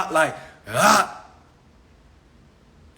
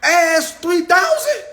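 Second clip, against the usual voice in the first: mock excited dude; 10 semitones higher, 3 dB louder than usual. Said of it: "Ain't no dude walking into his homeboy crib".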